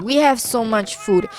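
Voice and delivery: usual speaking voice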